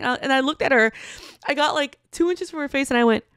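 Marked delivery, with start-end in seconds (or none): none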